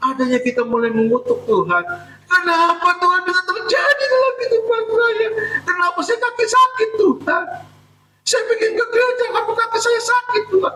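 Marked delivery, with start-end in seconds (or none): 2.3-10.7 high whining complaint; complaining to God. Acted. Sick believer blaming God, high whining complaint. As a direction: whine at God about the sore leg.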